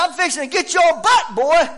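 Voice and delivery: loud, high parental scolding; re-enacted worn-out parent's outburst; agitated threat. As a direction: yell a warning at a misbehaving child. Retells threatening the boy.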